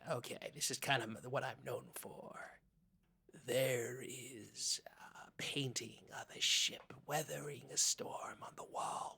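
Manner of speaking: soft ASMR describing a painting; ASMR whisper. Acted. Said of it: "do some A S M R here".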